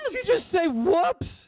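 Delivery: very high, slow disbelief; can't believe what he heard; incredulous. Acted. Disbelief after being shot.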